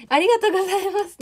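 急に声を大きく上げて高く、はしゃいだ感じのありがとうございます; ぱっと跳ね上がるような高い声でお礼を言う; 甲高いお礼. Real, not acted. チャット読みで急に高い声. (視聴者に向けて高く弾む声でお礼)

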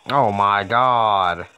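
loud, slow exasperation; exasperated. Real, not acted. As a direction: drag it out in disbelief.

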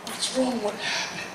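hushed, anxious question; a soft frightened whisper; worried whisper. Retold or imitated. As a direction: whisper in worry. Worried whisper over girl.

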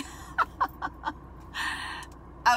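high burst of breathy laughter; surprised laugh. Real, not acted. Finding condoms in her purse, a high embarrassed laugh.

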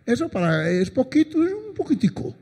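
devil's sly little coaxing. Acted. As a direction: tempt them in a small, high-pitched voice, climbing at the end. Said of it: "¿No le dice a usted el diablo así?".

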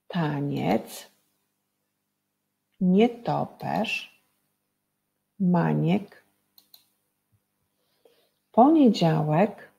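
each underlined word read slowly and set apart by pauses; teacher reading clue words one by one; slow word list. Shown as a demonstration. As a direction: lay out each word slowly for the class to spot the sound. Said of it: "Pomogą wam jeszcze podkreślone wyrazy".

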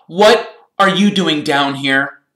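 high, loud, slow question; surprised. A narrator's reading. Ron running into Percy in the dungeons, surprised. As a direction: stretch it out loudly in astonishment. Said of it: "In surprise".

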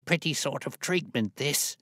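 high indignant outburst; indignant old man. Acted. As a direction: protest like a cranky old man. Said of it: "he cried".

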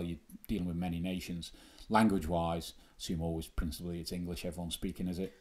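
ordinary delivery

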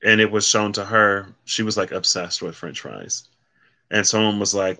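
ordinary talk, nothing marked

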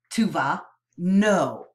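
ordinary delivery, nothing marked

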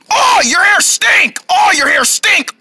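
drawn-out shouted complaint; disgusted wailing. Acted. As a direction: cry out at a stink. Disgusted by sister's unwashed hair.

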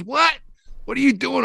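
high, sharp disbelieving question; mock shocked partner; startled what. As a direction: imitate a baffled partner. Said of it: "he goes".

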